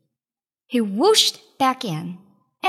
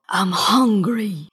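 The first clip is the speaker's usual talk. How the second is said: low, slow genie growl; deep slow monster growl; 5 semitones lower, slower than usual. A narrator's reading. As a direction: rumble it like a starving giant. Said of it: "he growled".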